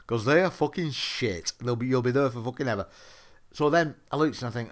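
ordinary talk

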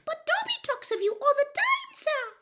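very high excited elf squeak; Winky, awestruck. A narrator's reading. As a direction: squeak with wonder as a tiny elf. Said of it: "looking awestruck".

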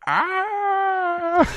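high, drawn-out comic scream; mock scream. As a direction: hold a long, high "ah". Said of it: "lean over and be like".

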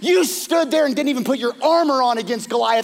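high, loud pleading outburst; impassioned plea. Retold or imitated. Michal confronting her father Saul for David, impassioned and loud. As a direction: stand up to him and let it pour out.

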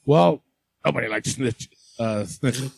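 deep, quiet old-man impression; lightly voiced old Vince; low old man voice. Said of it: "Vince is like".

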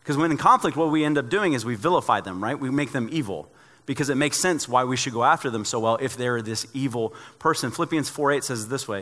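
ordinary talk

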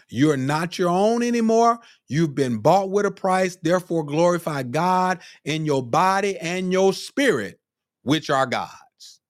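high, driving sermon delivery; fervent preaching. Real, not acted. Preacher tells the congregation to glorify God, fervent preaching. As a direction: preach it up high, rising with conviction.

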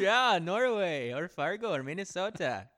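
a hint of Minnesota accent; lightly lilting Minnesota accent. Shown as a demonstration. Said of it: "talk like this".

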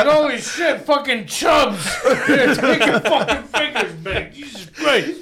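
high, slow laughing shout; laughing mock freakout. Freaking out at a friend's lost fingers, laughing shout. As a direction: howl it while cracking up. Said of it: "It's like".

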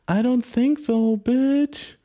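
high, drawn-out dismissal; slow sing-out put-down, climbing high; mock sassy. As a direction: answer with exaggerated high-pitched sass. Sassy answer to own rhetorical question.

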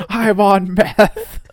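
loud, high, very drawn-out boast; mock unhinged declaration. Unhinged agent boasts to caller, hammed-up declaration. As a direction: proclaim it high, loud and slow.

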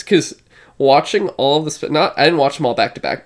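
normal talking voice

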